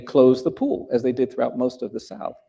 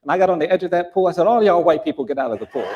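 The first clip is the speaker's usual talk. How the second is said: higher, quick, boastful retelling, laughing at the end; embellished bragging; 5 semitones higher, 5 dB louder, faster than usual. Retold or imitated. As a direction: ham up the pool story for friends. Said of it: "she would really embellish it".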